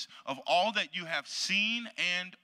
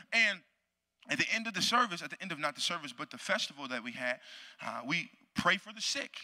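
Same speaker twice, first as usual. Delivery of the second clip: ordinary delivery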